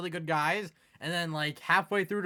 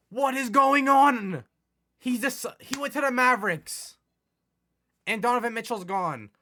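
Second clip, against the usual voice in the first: exasperated cry; 7 semitones higher, 7 dB louder than usual. Real, not acted. Gamer sees another star refuse him, crying out. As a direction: cry out at the unfair result. Second star rejects offer.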